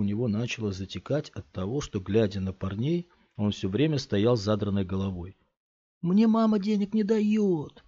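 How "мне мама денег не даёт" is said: put-on higher, childlike protest; pleading kid. A narrator's reading. Scott pretends to be a boy, pleading with the thugs. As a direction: sound small and scared.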